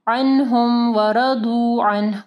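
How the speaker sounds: slow chanted अन्हुम व रदू अन्ह; thick d and long oo in रदू; emphatic daad. Shown as a demonstration. Teacher recites for students, measured heavy daad. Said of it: "व रज़ू नहीं पढ़ना".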